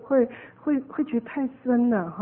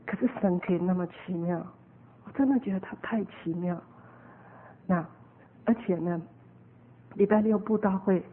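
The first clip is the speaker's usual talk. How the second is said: ordinary delivery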